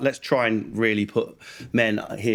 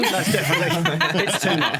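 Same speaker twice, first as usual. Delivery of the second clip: usual speaking voice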